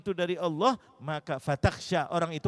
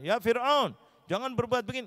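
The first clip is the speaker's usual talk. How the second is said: higher, stern rebuke to Pharaoh; harsh scolding; 7 semitones higher than usual. Retold or imitated. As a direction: scold him bluntly, the way not to speak. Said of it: "tidak mengatakan". (teguran keras dengan nada tinggi)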